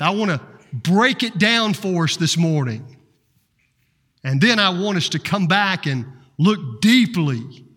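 ordinary talk, nothing marked

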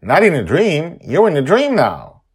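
high, loud arguing voice. Acted. Acted argument.

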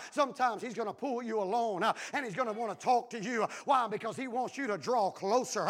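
ordinary delivery

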